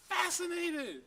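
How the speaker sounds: high, awed fascinated; up-pitched wonder on fascinated. Acted. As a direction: sound totally enthralled. Guy totally fascinated.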